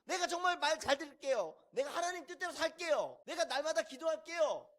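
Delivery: high-pitched mock pleading; desperate prayer. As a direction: bargain with God, begging hard. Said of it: "문제가 닥쳤을 때". (다급하게 매달리는 기도)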